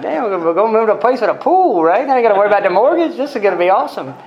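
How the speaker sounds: high, loud and fast, thrilled; gleeful excitement. Retold or imitated. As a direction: blurt it out like a kid who just won. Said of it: "I'm like".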